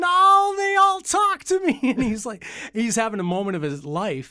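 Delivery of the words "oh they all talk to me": very high, loud, delighted squeal; overjoyed at being noticed; thrilled. Retold or imitated.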